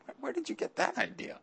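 high, soft, disbelieving cry; incredulous question. Retold or imitated. To someone saying they cannot serve God, incredulous high question. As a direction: sound stunned that anyone thinks this. Answering a false excuse.

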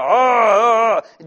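loud mock buzzer noise, belted out; a loud wrong-answer buzzer sound; mock buzzer blare. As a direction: blast out a game-show buzzer.

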